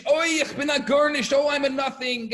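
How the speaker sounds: high, loud wailing mock scream; imitated Yiddish screaming. Student in the study hall late at night, imitated screaming. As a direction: wail it out across a hall. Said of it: "the other one is screaming on the other side".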